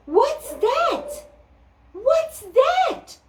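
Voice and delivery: high eager questions; animals asking. Acted. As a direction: ask together eagerly. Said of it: "asked the animals".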